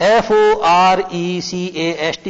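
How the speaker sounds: slow letter-by-letter spelling; spelled out. Shown as a demonstration. Teacher to pupils, spelling forecast slowly. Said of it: "spelling out forecast".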